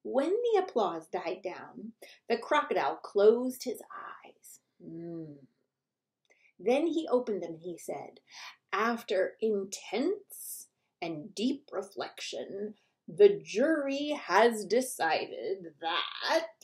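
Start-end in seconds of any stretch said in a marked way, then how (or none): 4.9-5.4 soft, low, breathy hum; whispered hum. Acted. The crocodile pondering, a hushed hum. Crocodile thinks, eyes closed. 8.7-16.5 slow, lower, formal verdict voice; solemn judge. Acted. The crocodile addressing the animals, slow and solemn.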